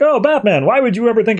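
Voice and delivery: high, sing-song mock innocence; mock innocent. Mad Hatter feigning innocence to Batman, high. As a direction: play innocent, high and sweet.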